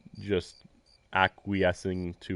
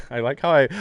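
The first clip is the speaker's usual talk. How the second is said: ordinary delivery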